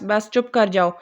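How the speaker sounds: usual speaking voice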